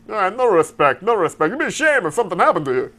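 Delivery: a hint of Dangerfield's nervous whine; Rodney Dangerfield impression. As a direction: say it like Dangerfield as a gangster. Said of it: "shifted into Rodney Dangerfield".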